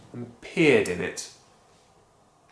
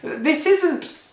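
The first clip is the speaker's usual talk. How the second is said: higher, loud, protesting; alarmed boy; 5 dB louder than usual. Acted. George protesting to Grandma, alarmed. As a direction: stop her in a panic.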